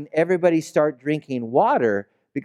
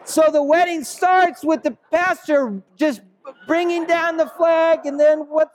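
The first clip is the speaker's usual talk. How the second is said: building excitement; 12 semitones higher than usual. Real, not acted. Punchline of funny wedding story.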